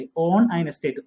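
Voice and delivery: "Own" drawn out very slowly; slow, careful model phrase; teacher stretching each word of "Own an estate"; stress on own. Shown as a demonstration. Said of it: "തുറന്ന സ്ഥലമാണെങ്കിൽ on എന്നും".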